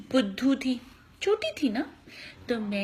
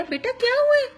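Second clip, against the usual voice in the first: kind uncle; 12 semitones higher, 5 dB louder than usual. Retold or imitated. Doctor uncle asking lost child.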